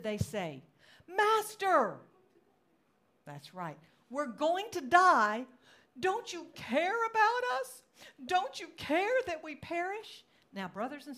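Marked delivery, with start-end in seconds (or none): 1.1-2.0 loud, high cry for help; dramatized desperate call; panicked cry. Acted. Disciples wake Jesus in the storm. 4.1-10.2 very loud, high, drawn-out wail; hammed-up terror, shouted over a storm; panicked pleading.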